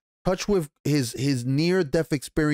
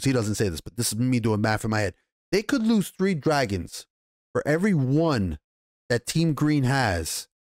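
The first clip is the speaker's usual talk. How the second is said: ordinary delivery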